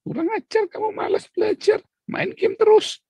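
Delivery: high shrill mock scolding of the child; high mock scolding, parent furious at the child; angry parent. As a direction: screech at the lazy kid in mock rage. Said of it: "dia marah sama anaknya".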